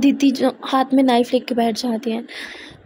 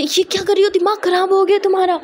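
higher, alarmed cry of disbelief; shocked outburst; 6 semitones higher than usual. A narrator's reading. Friend sees Aditi cut her wrist, shocked and alarmed. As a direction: recoil in horror at what she sees.

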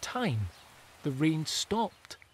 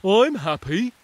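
lightly voiced happy mole; 4 semitones higher, 9 dB louder than usual. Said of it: "said a mole".

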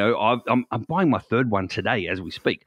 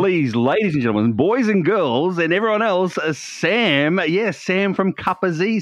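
drawn-out, higher game-show host intro; showman announcer; 6 semitones higher than usual. Pretend. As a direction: announce the guest like a stage host. Introducing a special guest.

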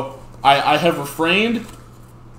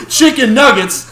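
high, loud delighted shout; excited shout; 11 semitones higher, 10 dB louder than usual. Real, not acted. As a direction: blurt it out, thrilled at the sight. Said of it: "sorry, I got distracted".

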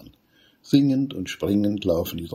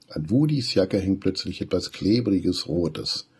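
usual speaking voice

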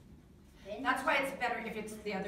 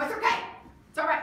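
loud reassurance; 6 semitones higher, 7 dB louder, faster than usual. Shown as a demonstration. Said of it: "And you go".

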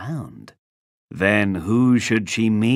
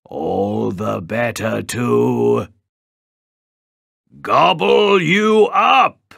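roaring wolf; 2 semitones higher, 5 dB louder, slower than usual. A narrator's reading. Wolf springs at the girl, slow build to a roar. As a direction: build slowly, then roar ferociously. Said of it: "roared the wolf".

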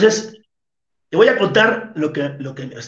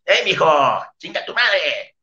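mock insult shout. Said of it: "una mentada de madre con la voz".

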